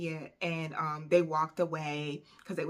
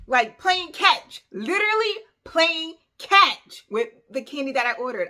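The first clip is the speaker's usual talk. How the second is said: indignant outburst; 9 semitones higher, 10 dB louder than usual. Real, not acted. Telling a friend how girls tossed her candy, outraged. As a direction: spell out the outrage word by word.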